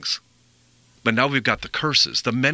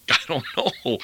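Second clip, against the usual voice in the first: laughing. Real, not acted. Laughs at his own dog question.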